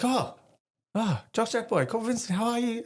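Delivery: high, hammed-up cheerful greeting; mock cheery officer. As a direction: ham up the boss greeting him sweetly. OC greets him in jockstrap.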